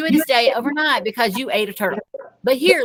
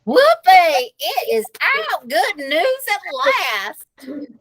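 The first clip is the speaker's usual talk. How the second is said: grandma shouting for joy; 6 semitones higher, much slower than usual. Acted. Grandma shouting the good news to Peter, overjoyed. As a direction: cheer it out like a delighted grandma. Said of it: "Grandma shouted".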